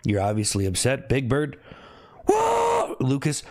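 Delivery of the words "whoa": very high-pitched "whoo"; sustained high whoo; high whoop. Real, not acted. Cheering out between callouts to players.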